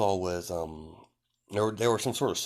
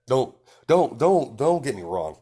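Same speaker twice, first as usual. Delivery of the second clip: louder, higher repeated "don't"; emphatic insist; 5 semitones higher, 7 dB louder than usual. Real, not acted. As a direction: cut in firmly to correct the impression.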